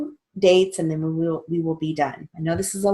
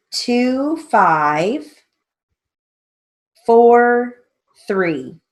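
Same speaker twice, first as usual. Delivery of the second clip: slow, spelled-out dictation; dictating; 2 semitones higher, 4 dB louder, much slower than usual. Shown as a demonstration. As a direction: read it out slowly for the class. Steno teacher dictating names slowly.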